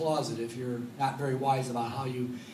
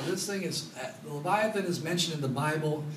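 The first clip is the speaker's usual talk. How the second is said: ordinary delivery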